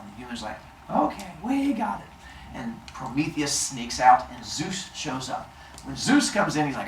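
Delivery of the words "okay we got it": high, soft agreement; meek high-pitched okay; obedient humans. Acted. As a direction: agree in a small, lifted voice. Humans agree in retold story.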